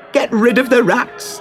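high shouting crowd; angry crowd shout. Acted. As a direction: yell it as a furious mob. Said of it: "they shouted".

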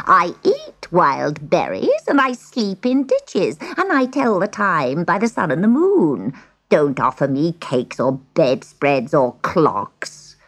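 slow, drawn-out witch bargaining; scheming old witch. Acted. Witch bargains for the cat, slow and sly. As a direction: savour the words slowly as a crafty witch.

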